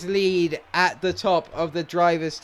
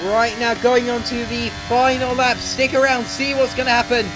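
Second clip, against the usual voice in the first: fast-flowing, urgent racing commentary; live play-by-play; 3 semitones higher, 3 dB louder than usual. Real, not acted. Gamer calling his own final-lap battle to viewers, excited play-by-play. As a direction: call the race live, raised and driving.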